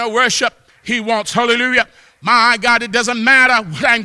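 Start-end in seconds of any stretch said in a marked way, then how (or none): none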